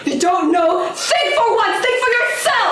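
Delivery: high, forceful shouted demands; angry berating. Acted. Armed partner berates the other for not thinking, shouting angrily. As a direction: hammer each command at them furiously.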